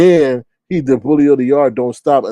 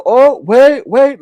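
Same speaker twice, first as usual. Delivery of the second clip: higher, louder mock double-take; imitating urgent interruption; 10 semitones higher, 7 dB louder, much slower than usual. Host retelling a man stopping his stream, urgent interruption. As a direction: cut in with a raised, urgent voice. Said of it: "in the way he said it".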